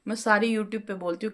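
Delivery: ordinary talk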